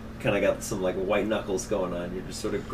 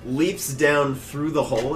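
ordinary delivery

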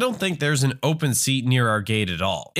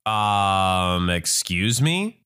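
slow, lowered incredulous question; taken aback; 7 semitones lower, much slower than usual. Acted. Traveler reacts to being ordered like a child, slowly incredulous. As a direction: draw it out in offended disbelief.

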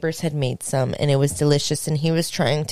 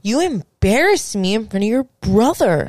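higher-pitched, indignant accusation; hurt, worked-up scolding, retold; retold anger; 5 semitones higher, 4 dB louder than usual. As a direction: replay being mad at a partner. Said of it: "I'm so mad".

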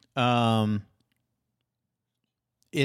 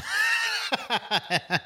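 squealing burst of laughter; high shrieking laugh. Real, not acted. Cracking up at the femme joke, shrieking laugh.

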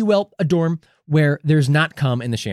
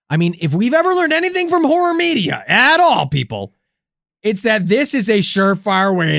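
excited; 7 semitones higher, 4 dB louder than usual. Acted. Horror-movie rant to audience.

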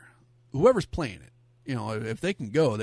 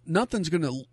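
usual speaking voice

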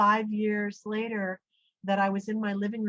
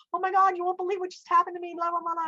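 fast, high-pitched excited gabble; breathless blurting; 8 semitones higher, 4 dB louder, faster than usual. Retold or imitated. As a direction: rattle off the news in one rush. Retells blurting news to husband.

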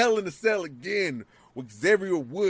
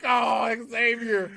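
mock shout of pity; 5 semitones higher, slower than usual. Retold reaction to mauling.

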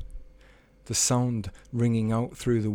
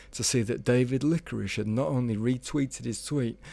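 plain reading voice

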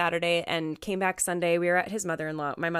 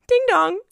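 higher, louder two-note chime; sung doorbell ding dong; 10 semitones higher, 5 dB louder than usual. Retold or imitated. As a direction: sing it like a doorbell. Imitating the doorbell.

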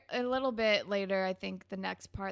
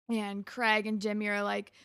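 usual speaking voice

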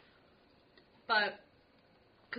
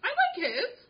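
high indignant character voice; 13 semitones higher, 7 dB louder, slower than usual. Pretend. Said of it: "I will be Aggie".

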